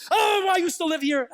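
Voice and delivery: exasperated parent. Acted. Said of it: "you know, like parents".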